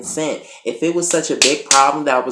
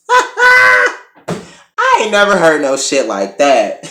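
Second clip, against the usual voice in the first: laughing shout; 8 semitones higher, 7 dB louder, much slower than usual. Real, not acted. Laughing at the odd line.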